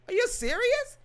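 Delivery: very high, loud disbelief; incredulous. Real, not acted. Vlogger incredulous at people's privacy fears. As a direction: squeal it in disbelief.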